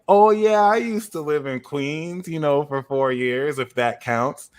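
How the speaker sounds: high, eager mock voice of himself; squeaky keen take on his own boast; mock eager self. Telling a Lyft driver he lived in Queens, high eager. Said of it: "I was like".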